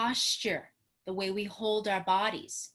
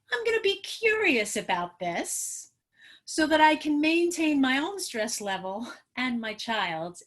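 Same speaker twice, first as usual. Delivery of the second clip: lifted well higher, light and curious; 4 semitones higher, 4 dB louder than usual. Shown as a demonstration. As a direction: float up into curiosity. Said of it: "your voice actually goes to a high note C".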